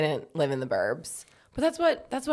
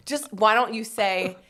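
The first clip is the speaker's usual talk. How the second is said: ordinary talk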